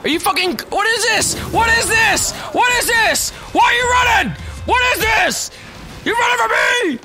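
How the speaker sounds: frantic shouting. Real, not acted. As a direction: yell at the fleeing enemy in outrage. Enemy running away in fight.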